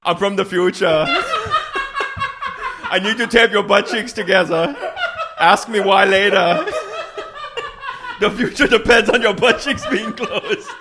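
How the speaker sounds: loud, high, urgent time traveler voice; hammed-up urgent warning, laughing through it; urgent future messenger. Time traveler urging a stranger, loud and high.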